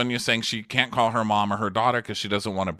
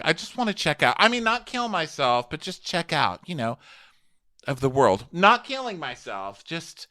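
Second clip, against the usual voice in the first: high, rambling put-on voice; Sonja impression; 5 semitones higher than usual. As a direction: backpedal in a higher pitch. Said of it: "So Sonya's like".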